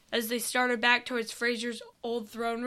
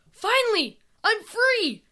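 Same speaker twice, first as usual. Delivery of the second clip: high, loud shout of relief; joyful cry; 10 semitones higher, 4 dB louder than usual. Acted. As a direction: cry out with joy at being free. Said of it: "as he cried out with joy".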